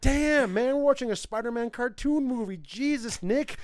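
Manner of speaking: high, loud incredulous outburst; mock astonishment. Moviegoer reacting to Nick Cage in a cartoon, mock astonishment. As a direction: exclaim it way up high, hammed-up disbelief. Mimics stunned reaction to Cage's line.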